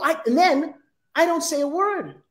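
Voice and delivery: usual speaking voice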